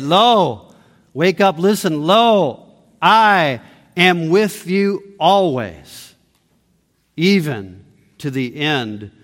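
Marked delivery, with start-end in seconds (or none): 0.0-0.7 Lo called out loud and drawn; a loud, held "Lo" to grab the room; emphatic Lo. Shown as a demonstration. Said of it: "that's as emphatic as you can get". 2.0-6.1 slow, weighty, emphatic "Lo, I am with you always". Shown as a demonstration. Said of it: "that's as emphatic as you can get".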